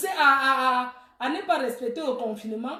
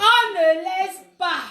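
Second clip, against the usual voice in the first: loud, slow, raised-pitch refusal; emphatic refusal; 4 semitones higher, 6 dB louder, slower than usual. Real, not acted. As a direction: cry it out, stretching the words. Refuses when told to let go. (refus crié, fort et traînant)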